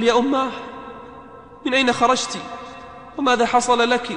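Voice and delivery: dying man sees his dead mother and cries out in alarm; startled call. Acted. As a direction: call out in shock to a ghost mother.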